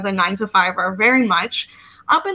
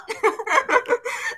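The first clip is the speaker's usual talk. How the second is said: high rising giggle; 14 semitones higher, 4 dB quieter than usual. Real, not acted. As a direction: giggle at the memory. Laughs at husband freaking out.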